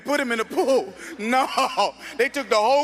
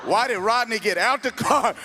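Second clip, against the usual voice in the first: ordinary delivery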